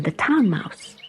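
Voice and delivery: usual speaking voice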